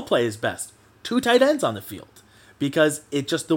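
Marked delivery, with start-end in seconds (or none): 1.0-2.1 much higher, ringing climax of the list; excited punchline. Real, not acted. Fan drives home his point to viewers, high and triumphant. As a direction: hit the last answer high and triumphant.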